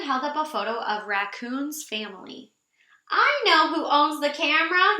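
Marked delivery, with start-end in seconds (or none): 3.1-5.0 higher and louder eureka call; shouted. Acted. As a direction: announce the discovery out loud. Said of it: "he shouted".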